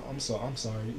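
very soft, retold sympathy; hushed condolence. Aunt phoning grieving nephew to console him, hushed sympathy.

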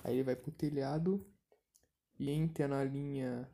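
normal talking voice

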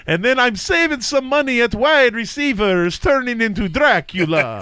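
high mock Italian slipping into a Transylvanian vampire accent; the fake Italian turns into Dracula; Italian accent drifting toward Dracula. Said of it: "it started off as Dracula".

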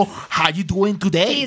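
acento americano lento y muy marcado; "How you doing today" estirado, con la boca bien abierta; americano exagerado. Retold or imitated. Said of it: "la boca se mueve como un torbellino". (saludo americano exagerado)

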